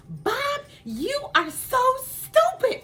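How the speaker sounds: high-pitched mock scolding wife; mom yelling. Wife yelling at husband that he's stupid, high mock yell. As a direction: shriek insults at the husband, high. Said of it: "my mom starts yelling".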